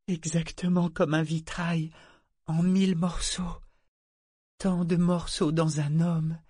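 voix haute, lente et égarée, émerveillée; divaguer, comme en plein délire; voix délirante. A narrator's reading. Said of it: "Sa voix divaguait comme s'il était pris de délire". (voix de délire émerveillé)

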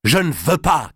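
furious high cry. Acted. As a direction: cry it out in anger. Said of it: "cria-t-il".

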